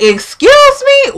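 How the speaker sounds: very high, drawn-out offended question; affronted disbelief. Retold or imitated. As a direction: stretch it out, voice leaping up in disbelief. Acted offended reaction to pay demand.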